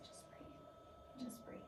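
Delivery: soft breathy whisper, barely voiced; whispered reassurance. Nurse soothing a patient who cannot breathe, whispered. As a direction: nurse leaning over a frightened patient, whispering calmly. Nurse calming her after waking.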